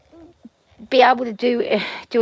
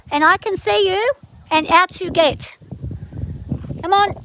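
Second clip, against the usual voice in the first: high, louder sing-song coaxing an animal; high coaxing pet talk; 9 semitones higher, 5 dB louder than usual. Real, not acted. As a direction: coax the hen out, high and bright-toned. Coaxing chickens out of coop.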